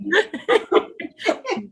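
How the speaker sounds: bright burst of laughter; high laugh. Real, not acted. Amused by the shower mix-up.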